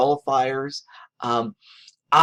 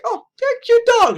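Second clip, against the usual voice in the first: imitating wife; 9 dB louder than usual. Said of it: "she's like".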